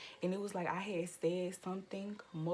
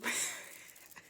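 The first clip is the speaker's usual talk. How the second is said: short breathy snicker; real laugh; 4 semitones higher than usual. Real, not acted. Speaker recalls plotting with the fan, mischievous snicker. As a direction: snicker at your own petty plan.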